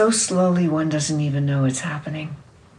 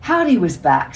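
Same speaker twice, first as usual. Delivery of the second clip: usual speaking voice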